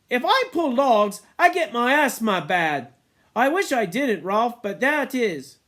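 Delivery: put-on high, loud pleading boy; whiny Piggy. A narrator's reading. Piggy explains to Ralph, high and pleading. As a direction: plead your weakness.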